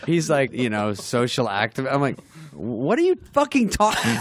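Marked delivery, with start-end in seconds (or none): 2.5-4.2 high-pitched incredulous outburst; mock exasperation. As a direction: retell it as stunned disbelief. Retold dismay at Farley's pitch.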